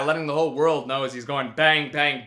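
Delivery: ordinary delivery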